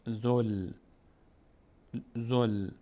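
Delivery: زل with ل closed and no vowel after; held sukun. Shown as a demonstration. Teacher modelling the silent lam in زل. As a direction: stop cleanly on the ل of زل. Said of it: "لام رو دقت کنیم که حرکت نکنه".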